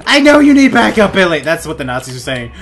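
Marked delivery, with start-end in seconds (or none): 0.1-1.4 high, loud mock-soldier voice; acted soldier callout. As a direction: shout back to a buddy in a fight.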